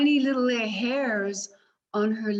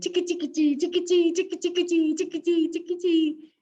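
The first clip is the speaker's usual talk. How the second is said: high, louder tickety rhythm; heartbeat patter; 9 semitones higher, 3 dB louder, faster than usual. Acted. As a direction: let the heart race. Heartbeat sound.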